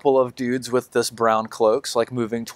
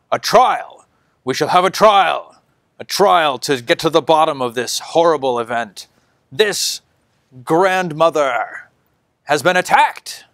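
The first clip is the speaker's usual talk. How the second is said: high, loud zealot preaching to a crowd; fanatic's proclamation; 5 semitones higher, 3 dB louder than usual. Acted. Fanatic judge rallying the crowd, loud and high.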